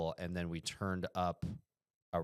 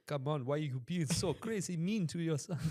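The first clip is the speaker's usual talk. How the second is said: high put-on voice, mock pleading; mock African guard; 10 semitones higher than usual. Guard chiding grandma for being mean, mock African guard. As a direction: plead as a comically concerned guard. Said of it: "the guards were like".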